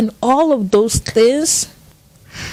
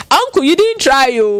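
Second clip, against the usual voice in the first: higher, lightly amused retold tease; amused teasing; 6 semitones higher than usual. Friend joking with her late dad at his funeral, lightly teasing. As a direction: imitate a friend making light of it. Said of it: "she just laughed with it".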